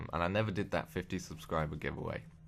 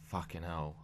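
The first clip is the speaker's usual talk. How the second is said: ordinary talk, nothing marked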